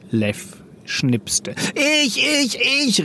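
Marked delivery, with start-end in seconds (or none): 1.8-3.1 high, insistent pupil voice; eager cry. A narrator's reading. Lev nearly climbing his desk to answer, high eager cry. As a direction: burst out high, desperate to be picked. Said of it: "rief er und kletterte fast auf seinen Tisch".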